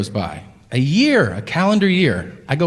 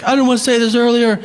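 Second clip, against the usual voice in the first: overeager fantasy confession; gushing; 6 semitones higher than usual. Pretend. Imagined excited waiter.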